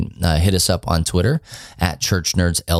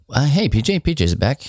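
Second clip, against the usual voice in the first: ordinary delivery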